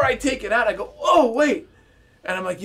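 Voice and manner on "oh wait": sudden idea. Retold or imitated. Sudden idea in retold scene.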